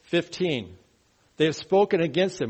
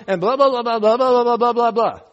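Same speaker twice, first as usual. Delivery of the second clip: higher, louder blah blah blah rant; mock complaining; 6 semitones higher, 8 dB louder than usual. Preacher mocking griping over government shutdown, loud whine. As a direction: mimic a whiny griper. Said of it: "I'm not getting what I want".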